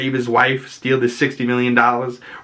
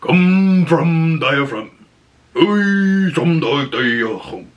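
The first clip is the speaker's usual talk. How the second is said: slow, put-on deep old man's voice; 6 semitones higher, 3 dB louder, much slower than usual. Imitating an eighty-five-year-old man urging you to breathe from the diaphragm. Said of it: "their voice just gets deeper".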